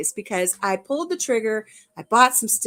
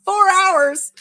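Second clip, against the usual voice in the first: higher, louder mock husband asking; husband's voice; 9 semitones higher, 6 dB louder than usual. Husband teasing her about four-hour lives, mock surprise. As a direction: imitate the husband, surprised and high. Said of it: "He goes".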